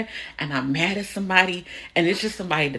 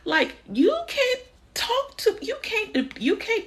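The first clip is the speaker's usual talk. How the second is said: high sing-song scolding. Retold or imitated.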